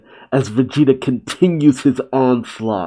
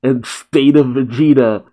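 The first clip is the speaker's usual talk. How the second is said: ordinary delivery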